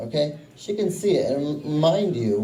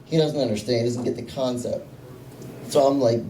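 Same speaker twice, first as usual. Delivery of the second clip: ordinary talk, nothing marked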